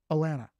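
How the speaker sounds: "Atlanta" as uh-LAN-uh, no t sounds; local Southern pronunciation. Shown as a demonstration. Trader drives home the lesson, "Atlanta" as Alanna. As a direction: repeat "Atlanta" the local way, t's dropped. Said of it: "That's just how we say it".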